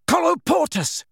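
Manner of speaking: high, urgent spell shout. A narrator's reading. Boy seals door against attackers, shouting. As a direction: cry the incantation out sharply. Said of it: "shouted Harry".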